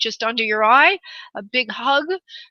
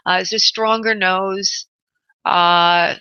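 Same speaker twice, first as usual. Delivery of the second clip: ordinary talk, nothing marked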